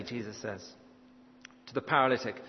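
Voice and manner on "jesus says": ordinary talk, nothing marked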